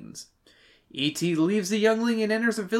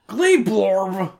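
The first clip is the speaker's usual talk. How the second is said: alien voice; 3 semitones higher, 5 dB louder than usual. Pretend. Inventing an alien name.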